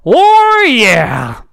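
hammed-up, drawn-out American exclamation; accent américain. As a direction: stretch it out like a caricature American. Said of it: "ça, américain". (accent américain exagéré et traînant)

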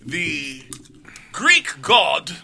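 loud, high proclamation voice; town crier. Pretend. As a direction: announce it like a town crier calling the square. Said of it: "I just gave y'all a little taste of how the, uh, next promo gonna be".

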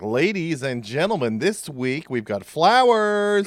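slow, loud, rising theatrical announcement; flamboyant store announcer. Retold or imitated. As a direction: relish it, stretching the last word high and loud.

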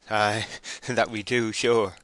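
nervous chuckle through the words; small anxious laugh. A narrator's reading. Matthew Moon placating the shepherd, anxious laugh. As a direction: agree with an uneasy little laugh. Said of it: "with a small, anxious laugh".

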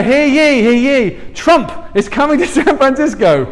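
loud, high proclamation, laughing at the end; town crier. Retold or imitated. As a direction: announce it like a herald. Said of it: "If you were to say".

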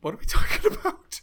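high, loud laughing exclamation; laughing bewilderment. Real, not acted. Co-host lost in the tangent, laughing in bewilderment. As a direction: cry it out, cracking up in disbelief.

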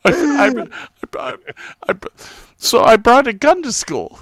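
put-on high, timid teen boy voice; nerdy kid voice.

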